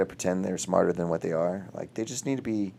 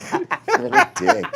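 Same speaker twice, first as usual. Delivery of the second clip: slow, high, laughing insult; 8 dB louder, much slower than usual. Real, not acted. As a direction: laugh it out in mock outrage.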